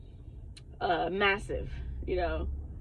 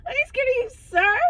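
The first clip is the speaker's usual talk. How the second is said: high, squeaky fake toughness; mock brave front; 5 dB louder, faster than usual. Facing the tall man, squeaky mock-brave insistence. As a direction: pretend to be brave while clearly scared. Repeating her bravado to the man.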